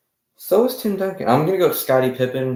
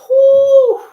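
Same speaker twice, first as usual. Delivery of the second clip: surprised whew; 14 dB louder than usual. Real, not acted.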